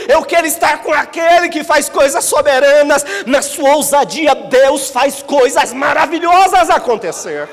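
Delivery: loud, high fervent preaching cry; preaching at full force. Acted. Pastor crying out to the congregation, loud fervent preaching. Bold declaration of following Jesus.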